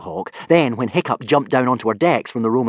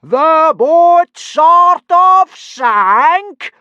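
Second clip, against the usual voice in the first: extremely loud, high, drawn-out roar; thunderous incredulous echo; Gobber roaring; 13 dB louder, much slower than usual. A narrator's reading. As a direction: roar it back slowly in disbelief. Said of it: "roared Gobber".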